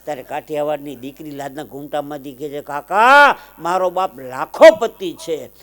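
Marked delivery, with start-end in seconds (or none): 2.6-5.5 daughter's proud declaration. Acted. Daughter speaks from behind veil.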